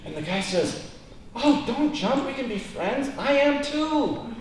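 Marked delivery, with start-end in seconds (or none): 1.3-4.3 higher, slow, eager relief; hammed-up delighted stranger; mock excitement. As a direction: joke teller's eager stranger, gushing. Excited stranger, joke climax.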